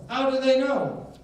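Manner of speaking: high, loud rhetorical question; emphatic challenge. Real, not acted. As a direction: pitch it up high and push it at the crowd.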